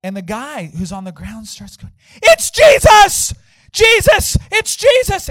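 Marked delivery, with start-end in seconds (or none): 2.1-5.3 high, very loud, stretched-out cries; retold screaming. Man on the ground crying out, high screaming. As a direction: scream it like a man overwhelmed by a vision. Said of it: "he starts screaming".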